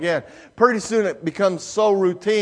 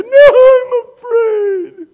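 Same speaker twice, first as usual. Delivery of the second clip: put-on high, loud, tearful child's refusal; frightened child crying; 11 dB louder than usual. Daughter refuses daddy from the slide top, high tearful cry. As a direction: whimper it like a scared little girl.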